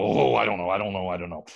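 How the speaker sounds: lightly voiced fussy experts fretting; a hint of flustered courtiers mumbling; dithering scholars. Court experts dither before Columbus.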